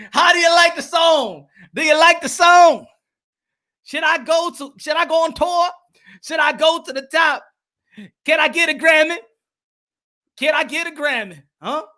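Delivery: high-pitched questions to the audience; high, eager post-song appeal; pleased showman. Real, not acted. Fishing for praise after singing.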